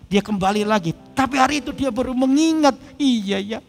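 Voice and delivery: preaching. Acted. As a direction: press the lesson on the listeners. Preaching at full force. (khotbah yang bersemangat dengan nada tinggi)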